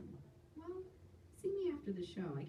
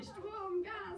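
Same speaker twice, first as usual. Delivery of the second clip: high, sing-song delivery of the words; mock punchline; 14 semitones higher than usual. Comic sells her German punchline to the crowd, mock triumphant. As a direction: present it like a big joke. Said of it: "That's comedy in German".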